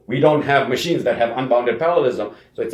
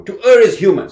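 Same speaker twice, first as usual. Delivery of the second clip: emphatic quote; 6 semitones higher, 8 dB louder than usual. Real, not acted. Lecturer reads famous quote emphatically.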